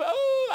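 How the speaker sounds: high, loud mock wail; fake cry. Boy conning folks for food, high fake wail. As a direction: put on a phony boo-hoo howl. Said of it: "I had a little fake cry".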